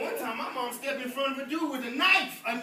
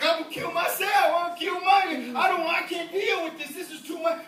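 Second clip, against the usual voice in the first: higher, louder despairing wail; despairing cry; 5 semitones higher, 5 dB louder than usual. Retold or imitated. The preacher picturing his children's tragedy, mock wail. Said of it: "I'd just be like".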